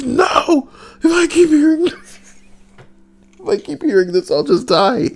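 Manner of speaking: high, frantic, overwrought plea; mock panicked scream. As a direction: ham up someone losing it. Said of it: "start screaming".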